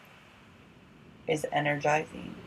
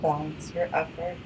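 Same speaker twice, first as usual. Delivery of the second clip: guided yoga instruction; 4 dB quieter, faster than usual. Acted. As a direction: guide the breathing gently, unhurried.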